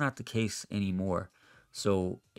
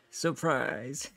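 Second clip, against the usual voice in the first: playful surprise, laughing; 5 semitones higher than usual. Real, not acted. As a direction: giggle through the surprise reveal.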